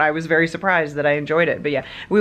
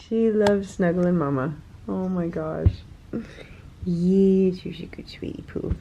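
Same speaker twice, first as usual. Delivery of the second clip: tender pet talk, fading to a whisper; 2 semitones higher, 4 dB quieter than usual. Real, not acted. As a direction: coo to the cat. Baby talk to the cat.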